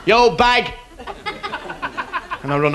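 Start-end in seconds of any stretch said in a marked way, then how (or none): 0.1-0.7 higher, louder mock yell; a mock road-rage yell; shouted insult. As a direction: fling the insult back out the window. Shouting back insult from car.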